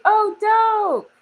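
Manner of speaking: sudden high, loud delight at a comment; excited. Real, not acted. Streamer reacting to a viewer from IG, excited high burst. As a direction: pop up bright and loud: oh, dope.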